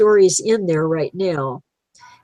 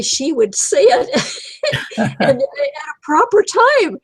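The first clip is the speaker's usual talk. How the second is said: laughing; 7 semitones higher than usual. Real, not acted. Laughing about the dog.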